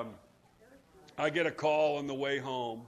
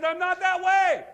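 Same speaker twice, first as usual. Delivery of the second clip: high, loud mock protest; 14 semitones higher, 14 dB louder than usual. Churchgoer's objection voiced by preacher, loud mock protest. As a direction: cry out the objection, half mocking.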